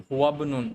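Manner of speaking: হুয়াবনুন as one word, hamza dropped; correctly linked. Shown as a demonstration. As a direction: slide from হুয়া into bnun without an i. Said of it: "এটা না কিন্তু".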